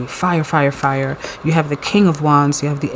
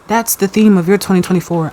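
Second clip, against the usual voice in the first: ordinary talk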